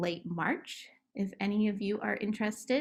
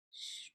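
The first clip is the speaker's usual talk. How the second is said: shush; 18 dB quieter than usual. Shown as a demonstration. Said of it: "hush in relaxation game".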